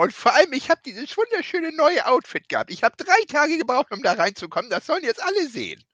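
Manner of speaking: high, prissy mock diva whine. Co-host mocks Nia Jax bragging about her outfit, high prissy whine. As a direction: play the vain wrestler showing off her outfit. Mocking Nia Jax's vanity.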